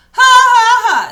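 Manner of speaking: high, loud taunting ha ha ha; mocking pig laugh. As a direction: laugh right in the wolf's face. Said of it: "said the pig".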